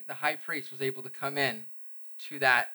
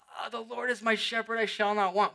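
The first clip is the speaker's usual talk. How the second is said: dying man moaning; 8 semitones higher than usual. Retold or imitated. Preacher mimicking a dying man quoting scripture, mock moan. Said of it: "moaning on a deathbed".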